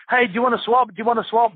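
high, eager call to a player, repeated. Retold or imitated. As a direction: shout after him, hoping to swap shirts. Chasing Redondo to swap shirts.

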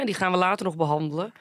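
normal talking voice

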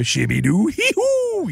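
goofy nonsense ending in a very high squeal; Adam Sandler. Retold or imitated. Comic tosses off a comedian's goofy noise, high squeal. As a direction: babble it like a silly man-child. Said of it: "Adam Sandler".

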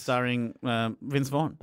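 ordinary talk

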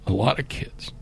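choked-up whisper. Real, not acted. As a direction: voice drops to a whisper. Emotional memory of poor children.

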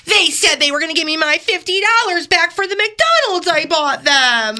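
high-pitched hammed-up complaint; whiny TV litigant. Litigant pleading to a TV judge, high mock whine. Acting out a court show litigant.

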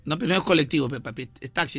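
low, rough voice of a pushy Lima cabbie; a touch of a sneering cabbie; rude taxi driver. Acted. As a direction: play the rude driver, deep and dismissive. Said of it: "Yo voy a actuar como el bruto".

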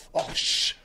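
whispered scoff "Osh"; scornful teen. Retold or imitated. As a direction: breathe out a disgusted "Osh". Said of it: "le dices algo".

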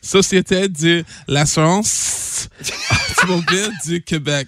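"Société de l'assurance" spoken slowly, syllable by syllable; slow careful French. Shown as a demonstration. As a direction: spell out the French name slowly. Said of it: "One more time".

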